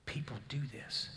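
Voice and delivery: hushed, breathy disbelief; stunned whisper to his wife; awed whisper. Retold or imitated. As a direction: whisper it to your wife in shock. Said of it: "looked at Jill and said".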